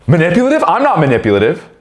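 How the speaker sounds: high, loud mock outrage; indignant denial. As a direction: imitate an offended, high-pitched protest. Acted outraged denial of accusation.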